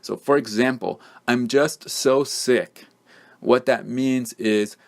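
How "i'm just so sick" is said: slow, stressed just so sick; emphatic just so. Shown as a demonstration. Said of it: "to strengthen a fact".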